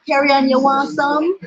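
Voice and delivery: a teasing Jamaican lilt, lightly done; Jamaican accent. Retold or imitated. Dad tempting vegetarian kid with soup, mock Jamaican accent. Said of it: "in his Jamaican accent".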